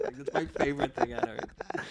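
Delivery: ordinary talk, nothing marked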